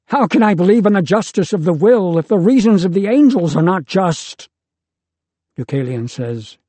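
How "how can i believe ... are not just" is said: higher, louder and fast, crying out; a wounded son shouting his protest at the angels. A narrator's reading. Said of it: "He shouts out".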